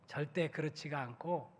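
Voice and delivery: ordinary talk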